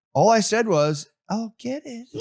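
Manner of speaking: innocent replay. Retold or imitated. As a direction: replay it as all sweetness and innocence. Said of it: "When they replay themselves".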